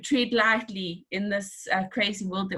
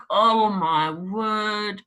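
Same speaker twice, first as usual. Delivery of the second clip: slow dismay; much slower than usual. Retold or imitated. Retold dismay.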